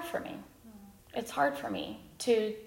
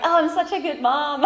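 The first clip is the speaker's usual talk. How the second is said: sarcastic self-praise; 7 semitones higher, 4 dB louder than usual. Retold or imitated. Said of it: "that's why I'm like".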